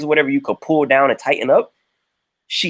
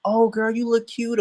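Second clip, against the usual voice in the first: put-on higher, drawn-out girly compliment; mock girlfriend gushing; 8 semitones higher, 4 dB quieter than usual. Girlfriends commenting on her pictures, mock gushing. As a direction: imitate a friend cooing over a photo.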